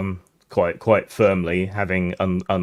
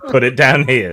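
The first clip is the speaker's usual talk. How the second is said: louder, higher commanding voice; acted goon, forceful and raised; guard's order; 5 semitones higher, 7 dB louder than usual. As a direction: order them about as an armed guard. Game master voices guard ordering players.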